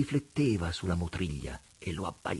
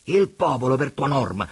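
retort; 7 semitones higher, 10 dB louder than usual. A narrator's reading. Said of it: "ribatté il Pigna roteando gli occhi".

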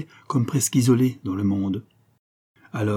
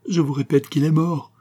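higher-pitched, distressed insistence; insistent, refusing comfort; 7 semitones higher, 4 dB louder than usual. A narrator's reading. Young woman refusing to hear her friends' reassurance, distressed insistence. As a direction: insist over their reassurance, pitch lifted. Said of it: "répéta la jeune fille sans vouloir l'entendre".

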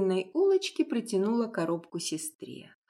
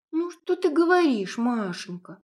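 put-on high, louder indignant girl; 6 semitones higher, 4 dB louder than usual. A narrator's reading. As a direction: protest in outrage. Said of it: "возмутилась Лиза".

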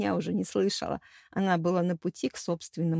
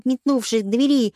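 ordinary delivery

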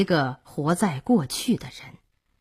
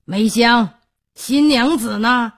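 higher, louder questioning call; general calling the maid; 5 semitones higher, 5 dB louder than usual. Acted. General demanding of maid Meixiang where the bride is, calling out. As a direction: call out for the bride as master of the house.

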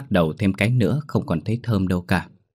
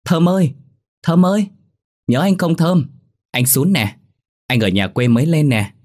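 loud, high, happy shout across the rooftops; joyful calling out; 8 semitones higher, 5 dB louder than usual. A narrator's reading. Calling across to Thơm at night, a loud joyful shout. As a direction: call her name out gladly from afar. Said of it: "Tôi mừng quá hô lên".

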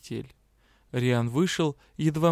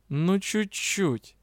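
very slow teasing offer; provocative; 6 semitones higher, much slower than usual. A narrator's reading. Magister provocatively coaxes his bride. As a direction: tempt her provocatively. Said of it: "провокационно предложил магистр".

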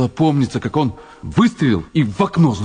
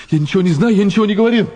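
panic; 8 semitones higher, faster than usual. Acted. Panicking denial.

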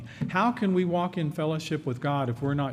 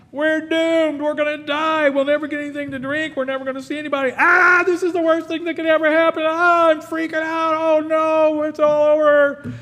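high, loud panicked wailing; panicking; 8 dB louder than usual. Acted. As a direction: freak out in full panic, voice way up. Panicking person locked in room.

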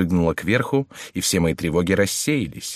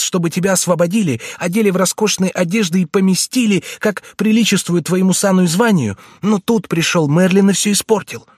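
put-on high, urgent pleading boy voice; frightened page boy; 10 semitones higher, 4 dB louder than usual. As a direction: plead anxiously like a scared young page.

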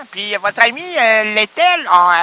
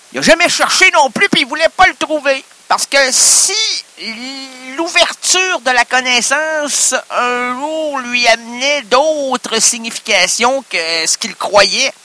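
pompous poet; 8 semitones higher than usual. Acted. Inspired philosophical improv.

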